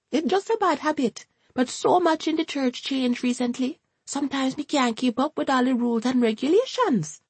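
high, Jamaican-lilting grandmother; a high, fussy Jamaican gran chatting; Hortense's Jamaican voice. A narrator's reading. Hortense confides to Ryan.

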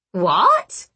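high, rising goblin question; sharply rising, astonished "what"; startled hobgoblin. A narrator's reading. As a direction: react as a baffled little goblin. Fenswick startled by the confession.

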